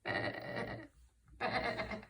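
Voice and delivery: short wavering sheep bleat; hen's bleat. A narrator's reading. Teacher demonstrates what the hen said, bleating. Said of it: "The hen said".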